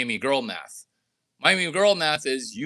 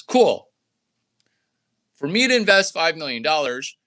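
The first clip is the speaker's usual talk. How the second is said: ordinary talk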